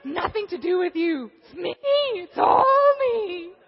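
high, loud pleading; loud confession. Acted. Husband insisting the fault is his, high loud plea.

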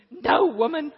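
high, loud laughing no; laughing protest. Acted. Husband laughing off his wife's worry, loud laugh. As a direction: laugh it off loudly.

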